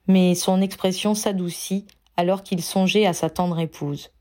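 ordinary delivery

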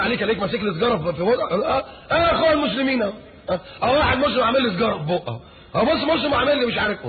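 high, taunting onlooker voice; mocking gossip. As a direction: point and jeer at the smoker.

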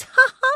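very high, loud short ha-ha; tipsy laugh. Acted. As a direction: cackle at your own joke. Tipsy woman laughs at own joke.

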